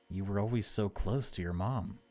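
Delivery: ordinary talk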